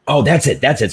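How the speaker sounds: light up as the idea clicks; excited realization. Real, not acted. To friends, realizing the premise: excited "that's it".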